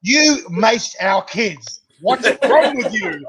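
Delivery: mock-indignant scolding; outraged wife. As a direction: imitate an appalled wife scolding, amused. Voices the angry wife accusing him.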